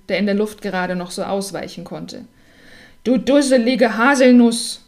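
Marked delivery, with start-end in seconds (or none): 3.0-4.8 louder, higher scolding; hen grumbling. A narrator's reading. Hen in the cap scolding the hazelnut, louder. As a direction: nag the hazelnut like a cross hen. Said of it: "meckerte das Huhn mit der Mütze".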